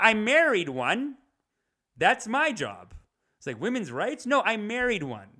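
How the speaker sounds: high, loud smug pundit impression; mock right-wing pundit. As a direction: ham up a dismissive conservative talking head. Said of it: "their whole attitude towards women is like".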